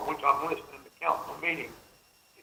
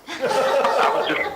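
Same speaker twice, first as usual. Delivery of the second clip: high, slow laughing words; 11 semitones higher, 6 dB louder, much slower than usual. Real, not acted. Laughs on realizing he's heard.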